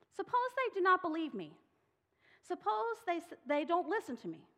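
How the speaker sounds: high, loud, anxious protest; worried Moses begging to be let off. Acted. Moses voiced pleading with God.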